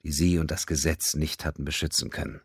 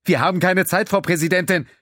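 higher, louder urgent objection; raised frantic protest; 13 semitones higher, 7 dB louder than usual. A narrator's reading. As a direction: push back urgently. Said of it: "protestierte Panganiban".

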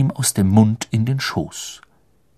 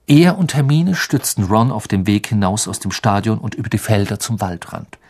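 ordinary delivery

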